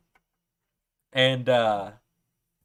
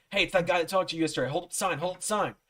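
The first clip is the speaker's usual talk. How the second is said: high, rushed prompting; excited urging; 5 semitones higher, faster than usual. Retold or imitated. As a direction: urge a friend on before the chance passes. Seatmates urge him in story.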